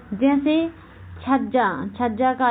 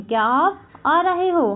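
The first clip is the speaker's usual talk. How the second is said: क्या आप आ रहे हो with आ lifted high; demonstrated question, pitch peaking on आ in क्या आप आ रहे हो; questioning intonation; 6 semitones higher than usual. Shown as a demonstration. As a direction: ask क्या आप आ रहे हो in a higher, lilting voice. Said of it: "अनुतान का प्रयोग कर रहे हैं".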